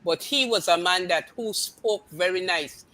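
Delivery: usual speaking voice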